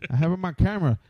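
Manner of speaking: normal talking voice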